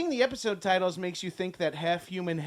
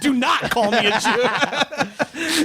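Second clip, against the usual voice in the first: mock outraged shout; 4 semitones higher, 6 dB louder than usual.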